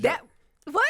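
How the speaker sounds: high, punchy incredulous questions; mock disbelief. Wife questioning what made husband beep, mock disbelief. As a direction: act as if you can't believe it. Acting out jealous spouse hearing beeps.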